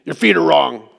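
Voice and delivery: a hint of a gruff stranger, tone falling on feet; lightly voiced curt stranger correcting form; mystery voice. Gym stranger tells preacher at the squat rack, lightly gruff. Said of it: "I just hear this voice say".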